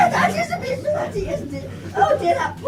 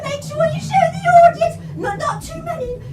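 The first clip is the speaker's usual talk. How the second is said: usual speaking voice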